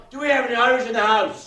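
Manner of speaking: loud call-out in an Irish persona; calling to the crowd. Acted. Host in Irish persona calling out to the audience, loud. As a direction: rouse the house with a big question.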